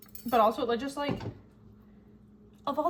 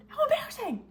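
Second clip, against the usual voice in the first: much higher squeal of embarrassment; embarrassed squeal. Real, not acted. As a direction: squeak it out, cringing. Cringing at the memory.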